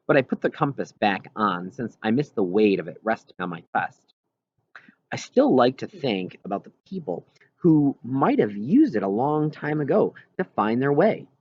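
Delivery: ordinary delivery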